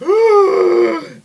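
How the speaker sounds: sharp, very high gasp of fright; frightened gasp. Retold or imitated. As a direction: suck in air as if the van is about to blow. Scared by the smoking van.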